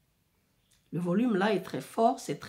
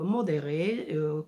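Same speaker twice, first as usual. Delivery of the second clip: ordinary delivery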